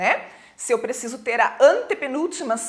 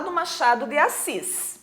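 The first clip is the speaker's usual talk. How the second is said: ordinary delivery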